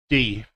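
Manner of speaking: "D" with the tongue at the top of the palate; D sound demo. Shown as a demonstration. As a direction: tap "D" off the palate. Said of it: "when you pronounce a D".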